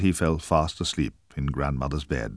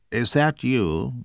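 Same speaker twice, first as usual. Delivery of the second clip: higher, slow mock-frail croak; a wolf faking a weak old granny; wolf posing as grandmother; 8 semitones higher, 3 dB louder, slower than usual. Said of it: "croaked a faint voice".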